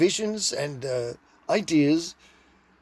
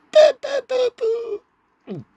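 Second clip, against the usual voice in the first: mock guitar wail; 6 dB louder than usual. Wild high guitar riff voiced.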